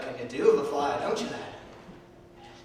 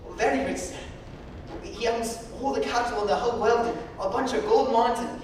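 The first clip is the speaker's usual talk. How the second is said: put-on higher, eager boy bragging; boastful orphan boy; 7 semitones higher, 7 dB louder than usual. Orphan boy boasts to the blind man about his father, eager. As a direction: brag like a proud little boy.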